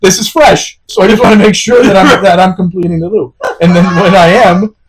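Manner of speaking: high, loud, laughing through words. Retold or imitated. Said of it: "I'm very sarcastic too".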